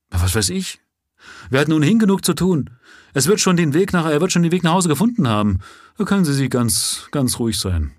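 irritated constable. A narrator's reading. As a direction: wave the question away impatiently. Constable Rance voiced in story.

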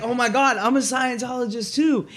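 high, loud mock delight; put-on starstruck squeal; fake excitement. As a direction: gush as if you've met a fellow believer. Pretends to be a fellow believer.

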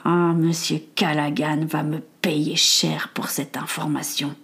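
Jack the spy murmuring to himself; murmured villain. Acted. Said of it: "Jack s'arrêta et murmura".

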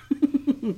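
high, light chuckle; real chuckle. Real, not acted. Man who likes being funny, chuckling. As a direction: chuckle at your own remark. Laughs at own remark.

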